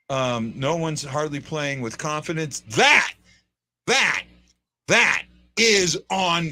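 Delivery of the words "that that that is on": THAT punched loud each time, with pauses between; emphatic blame. Real, not acted. Host pinning poor confidence on the coach, emphatic blame. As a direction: hit each "that" hard, then stretch "is on" slowly.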